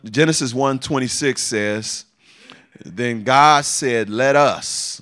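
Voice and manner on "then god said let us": slow, loud, weighty reading; booming scripture reading; emphatic scripture read. Real, not acted.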